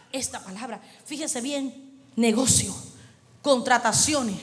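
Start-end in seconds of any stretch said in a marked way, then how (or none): none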